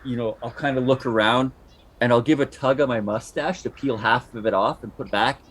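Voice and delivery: ordinary delivery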